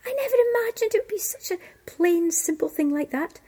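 high, quick disbelief, easing down; incredulous surprise. Acted. Lord in waiting first sees the plain bird, incredulous. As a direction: marvel at how ordinary it is.

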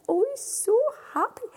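high, fast happy telling; excited. A narrator's reading. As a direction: tell it bursting with joy. Narrator excited.

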